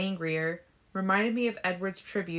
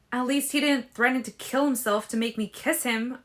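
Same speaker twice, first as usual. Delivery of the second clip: higher, louder angry retort; angry snapped retort, higher and louder; 6 semitones higher, 6 dB louder than usual. A narrator's reading. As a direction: lash back at him, stung. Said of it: "I snapped".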